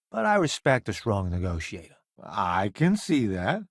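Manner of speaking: ordinary talk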